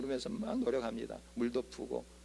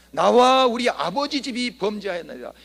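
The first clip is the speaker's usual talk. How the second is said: higher, louder, fervent cry of confession; 6 semitones higher, 10 dB louder than usual. Acted. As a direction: lift the pitch and cry it out to God. Nehemiah's prayer quoted again.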